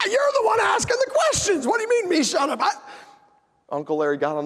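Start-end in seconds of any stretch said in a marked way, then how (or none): 0.1-2.7 higher, louder, outraged; indignant shout. Retold or imitated. Nephew told to shut up, shouting back indignantly. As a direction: protest in mock outrage, shouting.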